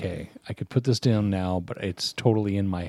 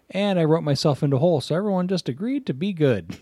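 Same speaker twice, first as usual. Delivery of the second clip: higher, fast sheepish confession; mocking the author; 8 semitones higher than usual. Mocking a novelist's lazy ending, higher and fast. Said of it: "the endings he comes up with were just like".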